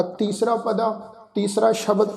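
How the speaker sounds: ordinary talk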